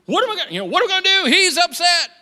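high, loud mock panic; hammed-up worried God. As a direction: play a flustered husband fearing his wife.

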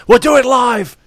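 high, loud excited yell; excited shout. Real, not acted. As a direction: shout it out with glee. Excited call on air.

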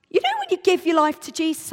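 loud, high, quick lecturing; high-pitched. Acted. Explaining faith to a woman at the station, high-pitched and worked up. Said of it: "I'm getting all high-pitched, just like I was then".

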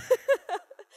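high sheepish giggle; embarrassed laugh. Real, not acted. Embarrassed confession.

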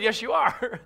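loud, playful insistence; mock insistence. Preacher mock-arguing with his own cut, loudly insisting. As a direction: insist back, half joking.

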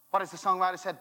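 ordinary talk

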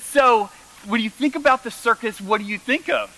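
imitating mom. Son mimicking his eager mom on the phone. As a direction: play the eager mother. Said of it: "She said".

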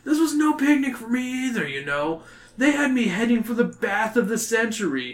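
pleading Jake. A narrator's reading. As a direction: complain like a put-upon kid. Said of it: "Jake pleads".

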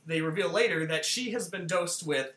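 usual speaking voice

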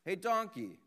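shouted call from above; yelling down. Acted. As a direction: holler down a well to someone. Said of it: "So the farmer yells down to him".

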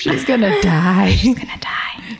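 whispered aside through giggles; gleeful whisper. Real, not acted. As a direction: whisper it like a naughty secret. Giggly aside about the friend's mortification.

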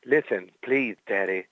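low, slow sultry plea; Jerome Boger. Pretend. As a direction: drawl it slowly, low and seductive. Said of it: "he'll even do it as Jerome Boger".

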